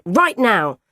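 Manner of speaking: acted insistent shout; urgent cry. A narrator's reading. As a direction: call it out as an order. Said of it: "I cried".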